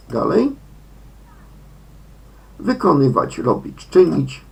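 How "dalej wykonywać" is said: ordinary talk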